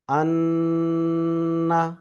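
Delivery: আন্না with a long, steady nasal hum on the nn; ghunna. Shown as a demonstration. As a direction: hold the ন্ন in আন্না very long through the nose, on one pitch. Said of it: "এটাও কিন্তু গুণনা হবে". (আন্না-র ন্ন নাকে টেনে গুন্নাহ করে)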